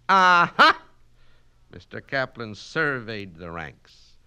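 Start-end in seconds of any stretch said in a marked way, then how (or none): 0.1-0.8 high, loud triumphant cry; triumphant aha. Acted. Kaplan catching Mitnick out, triumphant. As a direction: pounce on the point.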